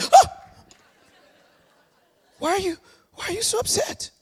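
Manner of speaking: high, timid gasping question; startled listener. Pretend. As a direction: imitate someone taken aback, in a high small voice. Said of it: "They're gonna be like".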